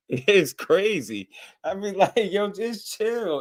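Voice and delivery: drawn-out high exasperation; exasperated plea. Real, not acted. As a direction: beg the fans to relax, half laughing. Laughing plea to calm fans.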